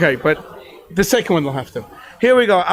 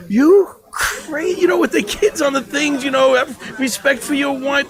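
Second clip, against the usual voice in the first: mock scolding; 6 semitones higher than usual. The rabbi scolding the teacher about his many kids, higher mock rebuke. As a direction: play the exasperated rabbi scolding him. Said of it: "Rav Bina came up to me and said".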